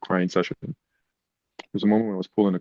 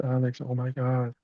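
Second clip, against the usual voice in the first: mock choked-up surprise; imitating an emotional caseworker; 6 semitones higher than usual. Caseworker greeting her former foster kid, lightly voiced tearful surprise.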